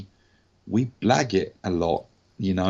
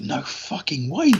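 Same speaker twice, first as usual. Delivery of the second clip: stunned delight; 7 semitones higher, slower than usual. Retold or imitated. Retold reaction to role offer.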